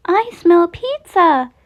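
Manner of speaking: high, slow, sweet chirpy voice; drawn-out sweet chirp; sweet chirpy Chica. A narrator's reading. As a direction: sing it out sweetly like a cheerful chicken. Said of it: "in her sweet, chirpy voice".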